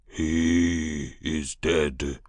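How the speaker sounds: slow, heavy beast man voice; slow beast creature voice. Acted. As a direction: drag the words like a beast. Beast folk creature speaks.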